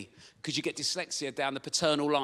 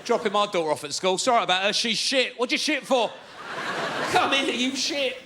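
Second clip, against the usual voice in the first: mock dad at drop-off; 5 semitones higher than usual. Dad at school drop-off shouting at kids, hammed-up. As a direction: play the rude dad at the gate.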